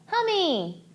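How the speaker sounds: put-on high, eager little-boy call; a toddler calling the hamster; excited toddler. A narrator's reading. Little boy racing in, calling the hamster high. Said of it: "Derek cried".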